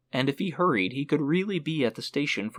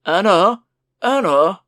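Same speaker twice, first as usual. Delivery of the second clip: loud, high shout into the kitchen; 7 semitones higher, 12 dB louder, slower than usual. Acted. Father shouting for the maid from the hall, loud. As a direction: bellow the maid's name. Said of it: "his father called into the kitchen".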